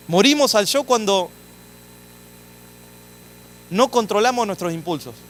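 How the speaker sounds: loud, emphatic preacher's declaration; forceful sermon delivery; preaching at full force. Acted. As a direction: hammer the point home to the church. Preacher drives home the point.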